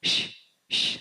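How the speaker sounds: sharp unvoiced breath bursts; breath of fire exhales. Shown as a demonstration. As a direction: blow out short forceful puffs. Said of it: "It's like blowing out a candle".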